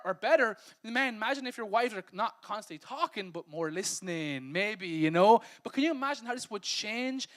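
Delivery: ordinary talk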